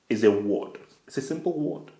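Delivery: ordinary delivery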